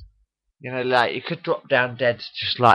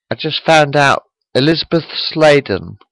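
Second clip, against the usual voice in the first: ordinary talk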